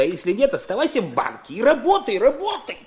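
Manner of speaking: stern, rising repeat of work; a hint of an angry father; stern order. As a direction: press the word работай harder the second time.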